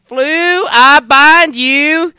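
loud, high Southern proclamation, long drawn out; mock Southern accent. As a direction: bellow it like a Southern faith healer. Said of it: "here's this lady, and she's like".

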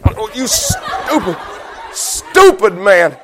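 slow, drawn-out mock scolding; outraged bride. Bride scolding husband after the cliff, in mock outrage. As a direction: stretch each word in comic outrage.

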